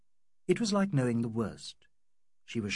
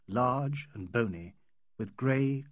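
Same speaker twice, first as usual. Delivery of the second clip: ordinary delivery, nothing marked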